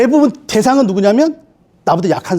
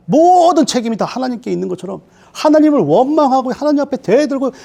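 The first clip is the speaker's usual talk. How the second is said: ordinary delivery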